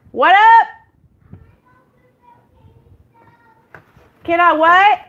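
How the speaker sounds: high, loud call upstairs. Real, not acted. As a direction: shout up the stairs. Calling to someone upstairs.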